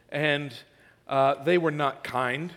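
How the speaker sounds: ordinary talk, nothing marked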